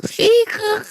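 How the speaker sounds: a quick high laugh; high giggle. Real, not acted. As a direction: giggle at the silly idea. Laughing about dolphins.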